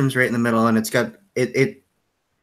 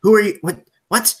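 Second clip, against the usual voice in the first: higher, louder, stammering affront; defensive and flustered, pitched up; startled stranger; 9 semitones higher, 5 dB louder than usual. Acted. Scarred stranger to players who startled him, startled and defensive.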